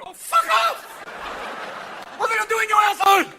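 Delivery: high, loud furious outburst; mock angry shout; angry yell. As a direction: yell at him in outrage. HP people yelling angrily.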